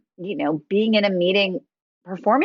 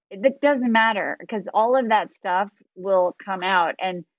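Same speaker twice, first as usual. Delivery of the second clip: ordinary talk, nothing marked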